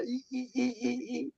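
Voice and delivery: high, soft squeaky bird chirps; bird squeaks. Retold or imitated. Telling about noisy finches, high squeaky chirps. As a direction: imitate finches squabbling, high and thin. Imitating birds arguing.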